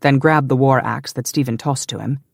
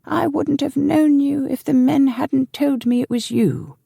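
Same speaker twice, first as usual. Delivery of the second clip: put-on slow, high-pitched old servant; old man; 13 semitones higher than usual. A narrator's reading. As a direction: talk high and slowly as an aged retainer. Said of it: "said an old man".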